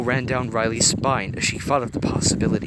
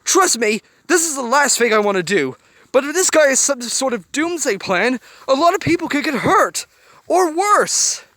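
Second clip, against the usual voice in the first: much higher, loud urgent warning; loud, far higher warning; alarmed warning; 9 dB louder than usual. A narrator's reading. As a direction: warn of disaster. Joy's loud fearful warning.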